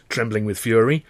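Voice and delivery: usual speaking voice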